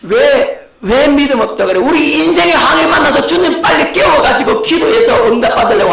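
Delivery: high, loud rising protest; preacher voicing the congregation's complaint; indignant protest. Real, not acted. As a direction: push back hard on the question. Preacher protesting on listeners' behalf.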